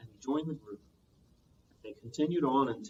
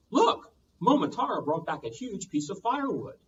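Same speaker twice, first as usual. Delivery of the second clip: surprised; 6 semitones higher, 4 dB louder than usual. Acted. Surprised old couple exclaim.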